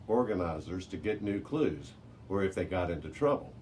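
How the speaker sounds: plain reading voice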